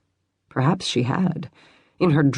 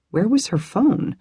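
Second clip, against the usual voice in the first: usual speaking voice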